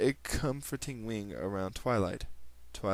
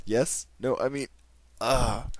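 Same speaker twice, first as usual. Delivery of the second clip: flustered; 2 semitones higher, 6 dB louder than usual. Acted. Twilight startled, flustered reply.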